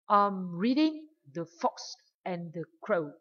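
I'm reading The Fox and the Crow with reading, Fox and Crow stressed; nhấn mạnh reading, Fox, Crow; stress on reading and names. Shown as a demonstration. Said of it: "đọc mạnh hơn vào động từ reading".

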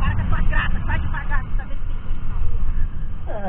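loud scolding, slowing at the end; angry scolding. Real, not acted. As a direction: tell him off, really fed up. Mãe brigando na moto. (bronca alta)

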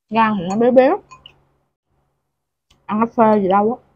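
talking between bites; mouth full, chewing. Real, not acted. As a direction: food in mouth. Seen 0: Eats before and during; jaw and lips chewing while speaking.

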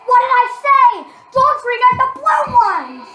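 heated gamer outburst; exasperated shout. Real, not acted. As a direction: yell it like a warning was ignored. Scolding during the game.

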